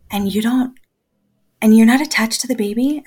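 mock disbelief from strangers; retold shocked stranger; shocked question.

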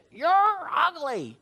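high, slow parrot squawk; yelling parrot. Acted. Parrot insulting a lady walking by, drawn-out high squawk. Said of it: "this parrot yelled at her".

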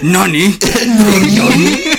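squealing Nani through laughter; mock anime shock, laughing. Anime catchphrase after You're already dead.

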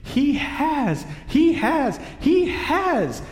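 very high, frantic little squirrel voice; squeak. A narrator's reading. Young squirrel defying the witch, frantic squeak. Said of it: "it squeaked".

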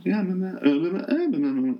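high airy parody of a bland actress, yeah after yeah; sing-song mock of her one note. Review vlogger mocks an actress who only says yeah, in a high parody voice. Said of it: "She is just like".

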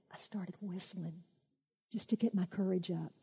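put-on soft whisper of a scared boy; hushed retelling. [name] recalling the ravine, hushed and scared. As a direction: whisper it, courage failing.

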